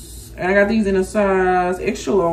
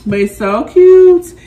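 gushing; 8 semitones higher, 9 dB louder, slower than usual. Real, not acted. Gushing over bag.

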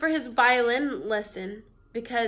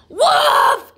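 high, loud desperate shout; desperate cry; 13 semitones higher, 10 dB louder than usual. Acted. Boy begs grandmother for help, high loud shout. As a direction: shout it so someone believes. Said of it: "he cried".

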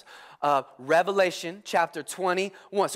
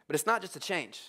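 ordinary talk, nothing marked

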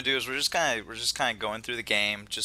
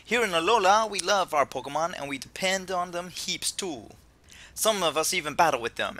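higher, animated Jamaican professor; attempted Jamaican accent; 5 semitones higher, 4 dB louder than usual. Host as professor introduces Pokemon to new trainers, Jamaican accent. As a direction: read it up high in a hammy Jamaican accent. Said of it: "I can't do a Jamaican accent".

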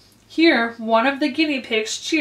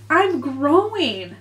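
slow, higher meek admission; 4 semitones higher, much slower than usual. A narrator's reading. Alice explaining to the Dormouse, very meekly. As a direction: draw it out meekly. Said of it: "said Alice very meekly".

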